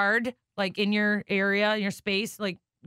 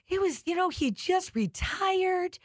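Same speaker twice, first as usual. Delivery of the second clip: higher, slow mock-sad mom voice; lightly voiced mother, drawn-out sad tale; imitating mom; 9 semitones higher, slower than usual. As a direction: lift it up like mom telling gossip, slowly.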